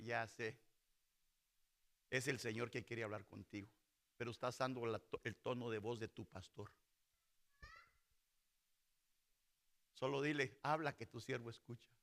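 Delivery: low, soft, knowing counsel; calm instruction. Acted. Elí tells Samuel how to answer God, calm and low. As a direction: advise quietly, well down in pitch.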